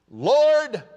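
high, very loud cry to the Lord; tearful crying out. Acted. Preacher as the child's father pleading with Jesus, crying out. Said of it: "said with tears".

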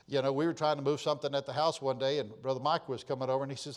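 ordinary delivery

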